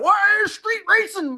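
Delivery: high-pitched, hammed-up accusing cop; mock police challenge. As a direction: imitate an over-excited officer calling out. Imitating police pulling him over.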